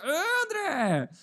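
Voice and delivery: high, startled, overly polite; flustered trainer. Retold or imitated. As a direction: caught off guard, high nervous voice. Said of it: "O cara já ficava assim".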